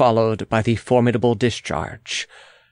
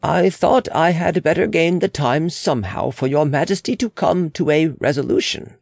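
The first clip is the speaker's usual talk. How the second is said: higher-pitched, deferential official's voice; explaining official; 5 semitones higher than usual. Acted. Official excusing himself to the Queen, higher and formal. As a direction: explain yourself in a raised pitch.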